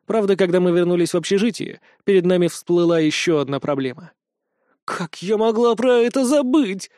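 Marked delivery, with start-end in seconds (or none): 4.8-6.9 high, slow, drawn-out wail. Acted. Wolf goddess wails in dismay at bedtime, slow and high. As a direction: lament a forgotten problem aloud. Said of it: "взвыла Лина".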